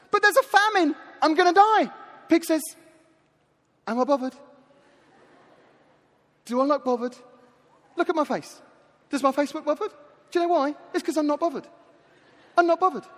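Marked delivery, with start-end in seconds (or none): 0.1-1.9 high, louder comic protest; hammed-up desperate plea, pitched up; desperate lost son. Starving son protests to the pig, high hammed-up desperation. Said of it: "Lost son says". 3.9-13.0 couldn't-care-less catchphrase routine, rising in pitch; mock teenage-sketch indifference; Catherine Tate. Pig brushes off the dying son, comic sketch indifference. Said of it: "how Catherine Tate would act out".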